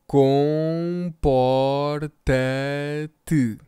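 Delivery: ordinary talk, nothing marked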